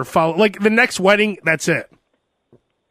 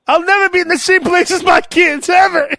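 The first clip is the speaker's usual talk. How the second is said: pompous royal; 10 semitones higher, 3 dB louder than usual. Pretend. William voiced as a bloodthirsty royal.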